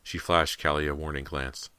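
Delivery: ordinary delivery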